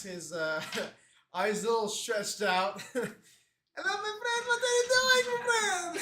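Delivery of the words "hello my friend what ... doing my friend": very high, put-on accented voice; hammed-up squeaky friendly stranger; Rahul, eager greeter. Introduces new character Rahul.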